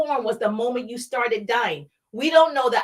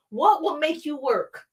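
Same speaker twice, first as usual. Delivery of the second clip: ordinary talk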